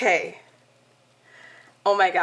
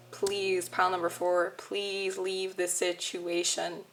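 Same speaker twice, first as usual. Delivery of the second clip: slow, much softer plea; soft pleading; 7 dB quieter, slower than usual. Real, not acted. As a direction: gently beg them to go. Begging listeners to leave relationship.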